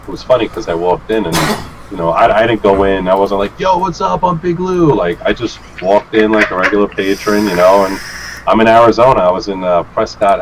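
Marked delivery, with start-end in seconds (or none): 3.6-4.9 high-pitched hammed-up big-shot entrance; put-on cocky hello, pitched up; mock showy greeting. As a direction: announce yourself like a mock celebrity. Mock showy entrance he didn't make.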